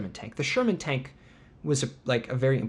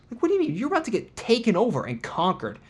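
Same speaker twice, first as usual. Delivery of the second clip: high-pitched indignant disbelief; incredulous; 9 semitones higher than usual. Acted. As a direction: protest it, pitch shooting up on the question. Mock outrage at the plaque.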